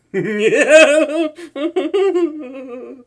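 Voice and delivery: nervous high giggle. Acted. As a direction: laugh off the guilt with a high giggle. Villain giggles after knocking her out.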